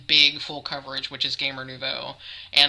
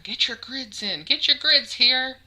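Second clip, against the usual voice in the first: lurid sideshow teasing; 10 semitones higher than usual. Acted. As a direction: hawk it like a sideshow barker. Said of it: "like I'm some kind of sideshow barker".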